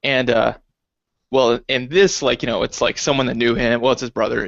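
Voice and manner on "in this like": usual speaking voice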